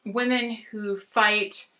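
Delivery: normal talking voice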